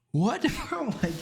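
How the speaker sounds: high-pitched disbelief breaking into laughter; real incredulous laughing. Real, not acted. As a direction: laugh helplessly through the words, voice shooting up. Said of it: "and I'm laughing".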